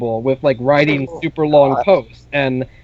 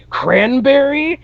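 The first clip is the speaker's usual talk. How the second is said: surprised recognition; 10 semitones higher, slower than usual. Retold or imitated. Recognizing an old friend online.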